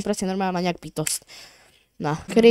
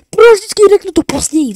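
high exasperated voice; 8 semitones higher, 7 dB louder than usual. Acted. Annoyed at game character.

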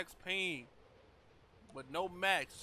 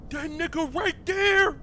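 gasp, then shout it higher, louder and slow; startled gasping shout; 13 semitones higher, 15 dB louder, slower than usual. Real, not acted. Streamer spotting the monster, scared yell. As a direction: jolt of fright, voice shooting up.

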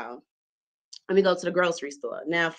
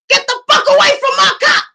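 high, loud angry shout; furious yell; 13 dB louder than usual. Retold or imitated. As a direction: scream at them from the window. Car owner yelling from window.

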